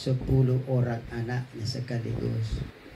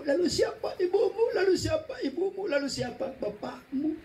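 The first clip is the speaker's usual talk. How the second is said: eager questioner, much higher; high questioning voice. Acted. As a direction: ask it high and insistent. (penanya bersuara tinggi)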